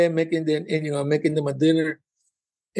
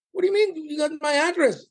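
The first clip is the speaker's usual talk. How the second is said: much higher, incredulous retort; indignant disbelief; 10 semitones higher than usual. Retold or imitated. Comedian retells protesting to the passport clerk, high incredulous pitch.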